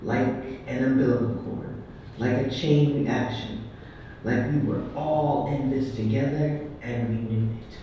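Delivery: loud, deliberate poetry performance; measured, declaiming poem recital; spoken-word poem. Acted. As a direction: proclaim each line like a stage poet.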